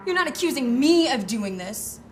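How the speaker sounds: loud indignant outburst; outraged. Acted. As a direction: shout it, shocked and offended. Realizes she's a suspect.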